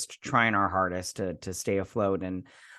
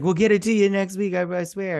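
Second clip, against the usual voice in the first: higher-pitched mock pleading; pleading promise; 8 semitones higher, 4 dB louder than usual. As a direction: imitate someone begging for more time. Voices bartender promising extortioners money.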